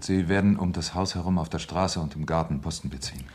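ordinary delivery

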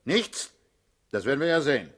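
high incredulous rising echo, then loud threat; scoff, then threaten; mock disbelief. Boss doubting the recruit's answer, loud mock disbelief. Distrustful of the suitcase.

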